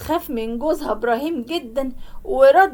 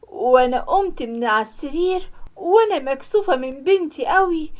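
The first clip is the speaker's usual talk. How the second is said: ordinary delivery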